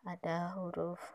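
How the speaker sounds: ordinary talk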